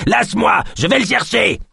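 high, frantic yell; shouting. A narrator's reading. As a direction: keep yelling as you struggle free. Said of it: "Il continua de s'agiter et de crier". (crié d'une voix aiguë)

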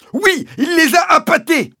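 very high, loud screech; exasperated shout. Acted. Landowner confirming his accusation to the sergeant, high angry shout. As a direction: yell it back in exasperation.

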